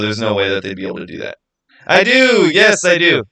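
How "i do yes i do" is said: excited yes. Real, not acted. Excited answer to chat question.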